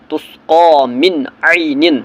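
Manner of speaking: in تسقا من عین the نون of من said clearly, not nasalised; slow recitation with clear نون; izhar on نون. Shown as a demonstration. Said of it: "نون ساکن کو ظاہر کر کے اظہار کرنا ہے".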